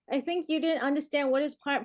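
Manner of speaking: normal talking voice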